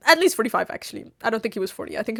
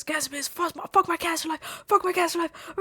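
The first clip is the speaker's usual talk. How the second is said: higher, muted imitation of a rant; mock yelling; 5 semitones higher, 5 dB quieter than usual. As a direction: imitate a ranting man without the volume. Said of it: "He just keeps yelling".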